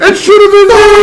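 shrieked high and loud through laughter; laughing shout. Acted. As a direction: yell it while cracking up. Joking outburst amid laughter.

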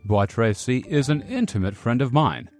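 low, mock-solemn guardsman; pretended seriousness, dropping low on the phrases; affected seriousness. A narrator's reading. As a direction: lay on the gravity in a low voice. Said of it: "addressed the young musketeer with affected seriousness".

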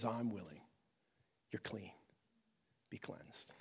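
very soft, low, tender voice; hushed acted compassion; gentle reassurance. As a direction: grant healing quietly, fading almost to a whisper. Jesus voiced gently healing the leper.

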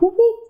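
high-pitched siren whoops; imitated police siren. Telling of being pulled over alone, imitated siren. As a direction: whoop like a cop car siren.

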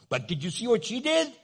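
high, indignant mock protest. Husband arguing with the Lord about his wife, indignant high protest.

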